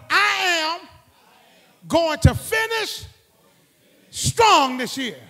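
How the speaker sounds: loud, slowing to a drawn-out finish; strong declaration. Shown as a demonstration. Preacher having the church declare it, loud and slow. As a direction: proclaim it, land on strong. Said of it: "Tell 'em".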